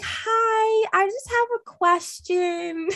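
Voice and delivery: small and timid on the phone; sweet phone voice. Shown as a demonstration. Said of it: "Really? I call and I'm like".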